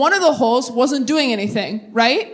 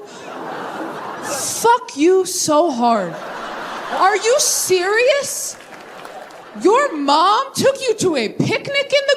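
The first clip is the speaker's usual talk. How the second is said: drawn out, high, laughing; laughing disbelief; 7 semitones higher, much slower than usual. Real, not acted. Comic reacting to the garden picnic, slow laughing disbelief. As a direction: stretch it out through laughter.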